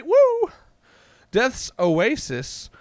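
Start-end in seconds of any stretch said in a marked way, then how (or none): 0.0-0.5 high loud whoop; whooping with joy at a strong card; cheer. Real, not acted. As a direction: whoop in delight.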